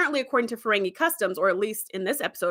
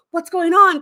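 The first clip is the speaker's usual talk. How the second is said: higher, louder call of alarm; alarmed shout; 7 semitones higher, 8 dB louder than usual. Retold or imitated. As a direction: call out to the shuttle in panic. Crew calls to dying pilot.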